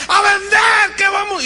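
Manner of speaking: very high, loud chant; crowd yell. Retold or imitated. Employees screaming back at the motivator. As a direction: roar it back at full volume. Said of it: "Más fuerte".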